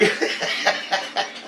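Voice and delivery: high breathy giggling; real laugh. Real, not acted. Storyteller tickled by the emoji question, laughing. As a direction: crack up after the punchline.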